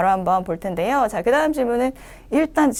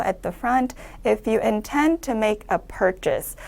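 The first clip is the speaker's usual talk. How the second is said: slow, softer reading, falling on "purchase"; falling end; 4 dB quieter, much slower than usual. Shown as a demonstration. Teacher modelling the line's end for students, slow and falling. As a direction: read it slowly and let it drop at the end. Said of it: "마찬가지 한번 내려 읽어주고".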